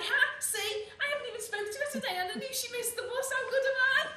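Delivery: high, very loud gloating; loud, high-pitched boast breaking towards laughter; triumphant crowing. Acted. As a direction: crow to the whole class, shouting. Pupil crows over being proved right.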